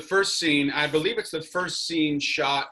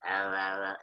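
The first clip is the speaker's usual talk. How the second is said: pirate growl; 7 semitones lower, 5 dB quieter than usual. Retold or imitated. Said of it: "I was kinda like".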